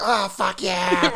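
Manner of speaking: high, drawn-out mock moan; aroused Greek. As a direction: moan very slowly in hammed-up pleasure. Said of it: "And they're like".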